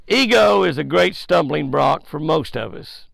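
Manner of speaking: ordinary talk, nothing marked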